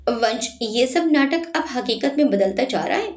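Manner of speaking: usual speaking voice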